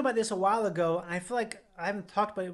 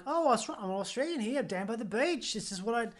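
higher-pitched put-on Australian accent; 4 semitones higher than usual. Shown as a demonstration. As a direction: do an instant Australian character, pitched up. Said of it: "if I do an Australian accent".

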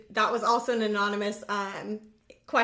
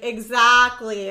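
loud, drawn-out confirmation; emphatic agreement; 8 dB louder, much slower than usual. Retold or imitated. Imitating him confirming what she said, emphatic. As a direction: stretch it out in firm agreement. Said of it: "he'll be like".

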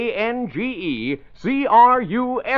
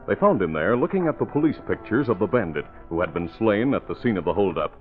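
lower, steady story narration; radio drama narrator; 3 semitones lower than usual. Acted. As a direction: set the scene calmly.

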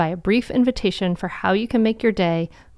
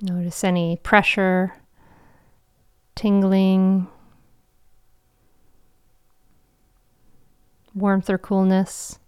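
guided meditation. Acted. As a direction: guide them into calm, with long pauses.

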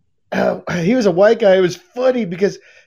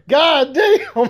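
laughing exclamation; 9 semitones higher than usual. Retold or imitated. Guy in stall laughing.

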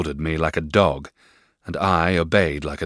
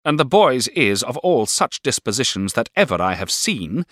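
higher, louder sour spinster; Miss Murdstone voice; 7 semitones higher, 5 dB louder than usual. A narrator's reading. Miss Murdstone condemns the boy, higher and sour. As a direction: spit it out with contempt. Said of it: "remarked his sister".

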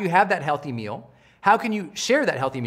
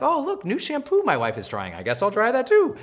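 distracted musing; 6 semitones higher than usual. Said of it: "I'd be like".

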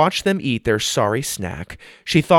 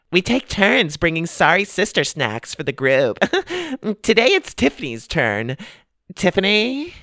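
put-on higher, perky mean-girl voice; lightly voiced bubbly girl; 10 semitones higher than usual. Tina explaining the club to Sophie, perky and higher. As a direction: chirp it like a bored queen bee.

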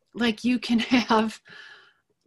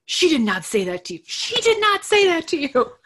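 imitating her sister's shocked disbelief, higher and louder; indignant mock outrage, pitched up; outraged sister; 9 semitones higher, 5 dB louder than usual. Retelling her sister's outraged reaction on the phone, shocked and indignant.

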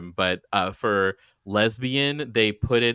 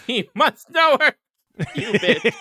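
high, loud mock-dramatic cry; mock outraged squeal; 13 semitones higher, 5 dB louder than usual. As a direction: squeal it in hammed-up outrage while laughing. Mockingly acts out bar confrontation.

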